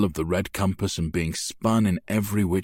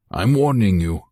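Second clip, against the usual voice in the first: a louder, biting warning; sharp snap; 2 semitones higher, 6 dB louder than usual. A narrator's reading. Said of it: "Guido snaps".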